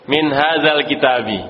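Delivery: ordinary talk, nothing marked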